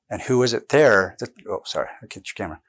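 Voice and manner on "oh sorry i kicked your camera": quick quiet aside. Real, not acted. Kicked the camera mid-talk.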